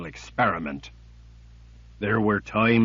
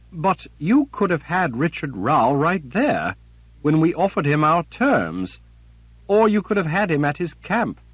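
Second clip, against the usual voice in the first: pleading higher woman's voice; 8 semitones higher, 5 dB louder than usual. Acted. Sister argues with Jagang.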